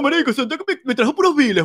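high mock whine; a hint of a sulky friend; mocking the guy. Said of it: "Y el bicho, no".

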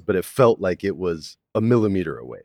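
normal talking voice

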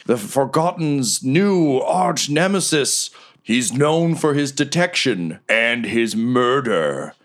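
high, very slow announcer reveal; ring announcer reveal. Acted. Riker presenting the challenger, slow grand reveal. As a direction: draw out the name for the big reveal. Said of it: "Riker gets back on the mic".

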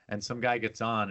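usual speaking voice

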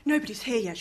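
usual speaking voice

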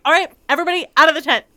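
rushed mock mom bellowing the kids out; mom ordering out. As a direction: hurry the kids out, fed up. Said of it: "she's like".

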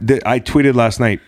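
ordinary talk, nothing marked

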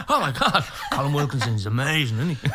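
high, gushing, laughing; acted fan excitement. Fan talks Superstar with bald amdram men, gushing. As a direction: gush like a fan through laughter. Acting out excited reply about singer.

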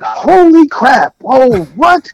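high, loud, slow stunned exclamation; astonished. Retold or imitated. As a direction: let it fall out of you in disbelief. Ken sees Janet's signature.

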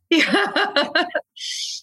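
high, real laugh. Real, not acted. Clinic worker laughing about her own accent, high laughter. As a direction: laugh out in a high run, tailing off.

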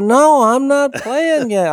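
very high, slow indignant denial; indignant old man. Retold or imitated. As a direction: protest like an offended old man. Old man denies, voiced high.

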